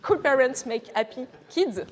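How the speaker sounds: slow, deliberate English accent, self-mocking; slow, careful English accent from a French speaker. Shown as a demonstration. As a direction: show off a proud English accent, tongue in cheek. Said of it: "vous allez voir mon super accent anglais".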